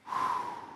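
long audible exhale; relieved sigh. Acted. Sinner after confessing to God, sighing in relief. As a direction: let the breath go after confessing.

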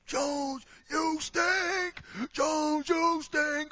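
high, loud jeering heckler yell; imitating a heckler's yell. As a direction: bellow it at a player across the arena. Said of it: "screaming at the top of his lungs".

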